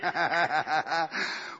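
real laughter; burst of laughter. Real, not acted. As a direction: break into a joyful laugh. Preacher erupts in laughter.

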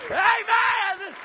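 very high, loud amen; shouted amen. Real, not acted. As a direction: belt out amen. Shouted amen after laugh.

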